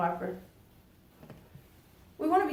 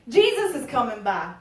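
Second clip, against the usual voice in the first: ordinary delivery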